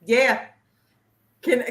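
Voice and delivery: ordinary talk, nothing marked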